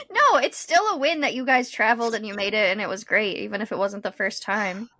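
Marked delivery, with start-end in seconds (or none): none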